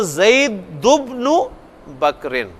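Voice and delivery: slow "ज़ैदुब्नु", zaidu-bnu without the n, then low "बकरिन"; shortened linked reading. Shown as a demonstration. Teacher repeating the model reading, slow and without tanween. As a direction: stretch "ज़ैदुब्नु" syllable by syllable, du straight into bnu, pause before "बकरिन". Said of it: "तो ये अब पढ़ा जाएगा ज़ैदुब्नु".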